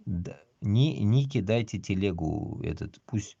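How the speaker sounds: normal talking voice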